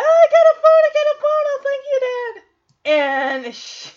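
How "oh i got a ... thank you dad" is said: high, loud gushing thanks; excited squeal. Retold or imitated. Fan imitating DJ thanking dad for the phone, overjoyed. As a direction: gush like a thrilled daughter. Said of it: "She's so excited".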